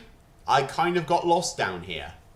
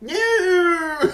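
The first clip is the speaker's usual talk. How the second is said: very high, loud drawn-out no; hammed-up high cry; mock wail; 6 dB louder than usual. Mock cry after hero impressions.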